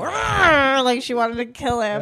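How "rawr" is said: mock growl, higher pitched; a playful "rawr" like a snarling dog; imitated dog growl. His dog about to attack, imitated growl. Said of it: "Mabel proceeded to go".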